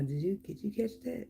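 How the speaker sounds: hushed aside to mama. Retold or imitated. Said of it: "I still got to ask my mama".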